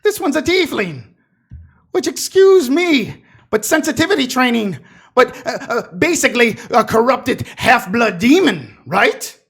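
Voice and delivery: high, loud sneering official; mock her loudly. NPC mocks tiefling loudly.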